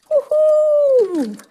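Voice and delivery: high, loud whoop; excited cheer. Pretend. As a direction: cheer the toy on. Cheering as Dozer fills up.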